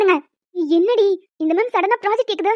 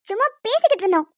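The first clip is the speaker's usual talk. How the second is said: normal talking voice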